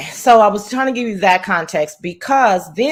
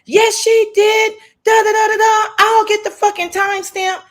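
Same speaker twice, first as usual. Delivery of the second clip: mocking imitation; 13 semitones higher, 3 dB louder than usual. Mocking the rival's reply to Rodney in a high sneering voice. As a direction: pitch it way up and drag it out to mock her.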